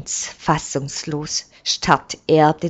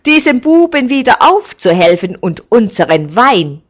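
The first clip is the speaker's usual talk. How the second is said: louder, fast indignant grumble; scolding; 6 semitones higher, 9 dB louder, faster than usual. A narrator's reading. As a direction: scold the master, fed up and fast. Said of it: "schimpft der Knecht". (schimpfend, schnell und laut)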